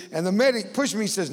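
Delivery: ordinary delivery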